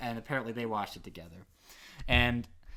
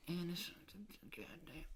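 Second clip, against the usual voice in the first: whispering; 5 semitones higher, 12 dB quieter than usual. Real, not acted. Whispered curse.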